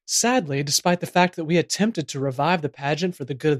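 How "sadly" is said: normal talking voice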